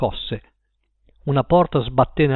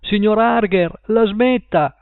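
even higher pitched shout to the neighbour; repeated shout; 7 semitones higher, 3 dB louder, slower than usual. A narrator's reading. Paul shouting again at Mr Harger. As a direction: call out again, more desperate. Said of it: "gridò".

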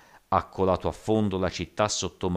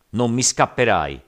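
loud, raised-pitch threat; angry threat; 6 semitones higher, 9 dB louder than usual. A narrator's reading. Giant swearing revenge on Zhao, threatening loudly. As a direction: throw the threat hard at him.

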